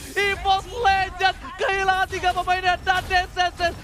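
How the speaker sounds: high, loud hype call; fighting game announcer. Acted. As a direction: push it up for the comeback. Hype esports play-by-play.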